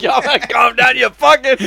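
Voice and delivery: high, laughing mock yell; laughing repeat. Cohost echoing the angry neighbor line, laughing high. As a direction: squeal it back through laughter.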